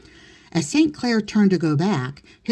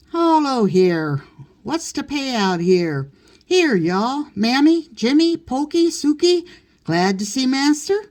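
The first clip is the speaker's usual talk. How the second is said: hearty greeting; 8 semitones higher than usual. Acted. Master greeting the servants at the door, hearty calling. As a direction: boom a jolly hello to the crowd.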